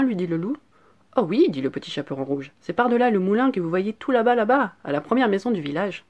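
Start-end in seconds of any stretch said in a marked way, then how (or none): 1.2-1.5 put-on higher, louder little-girl reply; eager little girl. A narrator's reading. Child answering the wolf, eager little-girl voice. 2.6-6.0 lightly voiced little girl, higher; eager little girl. A narrator's reading. Child directing the wolf, eager little-girl voice.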